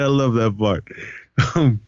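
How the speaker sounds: ordinary talk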